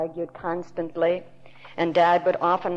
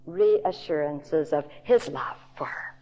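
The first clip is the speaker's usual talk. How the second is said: whisper. Real, not acted. Preacher softens on key phrase.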